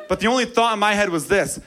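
normal talking voice

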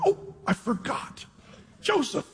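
high, soft mock dismay; sudden realization. Butler suddenly remembers the man in prison, mock dismayed realization. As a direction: act out a hushed, high "oops, I just remembered".

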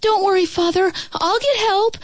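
put-on high boyish call over the shoulder. A narrator's reading. Son calls back to his dragon father, reassuring. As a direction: shout back reassurance while running. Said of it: "called over his shoulder".

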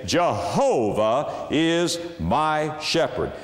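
slow, each word set apart, "Jehovah" drawn out on its middle syllable; weighty declaration. Shown as a demonstration. Preacher substituting the sacred name, slow weighty declaration. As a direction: proclaim it word by word, swelling on "Jehovah". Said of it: "And you can say".

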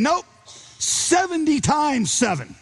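high, slow, emphatic "seventy times seven"; Jesus quoted. Retold or imitated. Jesus voiced in retold story.

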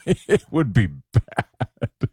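drawn-out words through a laugh. Real, not acted. Expert to host about an asteroid hit, laughing. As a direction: laugh while stretching the words out slowly.

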